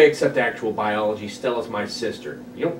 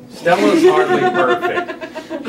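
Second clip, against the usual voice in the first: laughing; 7 dB louder, much slower than usual. Real, not acted. Reader cracks up mid-line.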